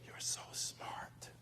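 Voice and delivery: slow hushed whisper. Retold or imitated. Teacher whispering to her student again, slowly. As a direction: breathe the praise out very softly. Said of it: "And she would say".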